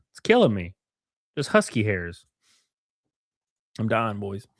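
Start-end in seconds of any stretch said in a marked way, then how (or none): none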